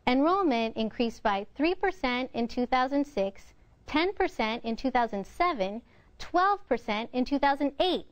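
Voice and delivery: a list read with pitch peaks on the figures and years; pushing voice up. Shown as a demonstration. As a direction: lift each percent and each year. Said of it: "voice is going up both on the percents and the years".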